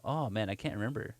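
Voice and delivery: ordinary delivery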